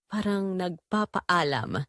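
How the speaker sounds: ordinary delivery, nothing marked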